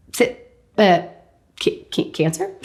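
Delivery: halting, stuck on the word cancer?; can't get the word cancer? out; stammering in shock. Retold or imitated. Patient stammers to her doctor, stuck in shock.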